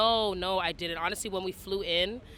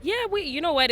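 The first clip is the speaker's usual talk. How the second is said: excited burst; 10 semitones higher, 8 dB louder than usual. Real, not acted. Excited reply about accents.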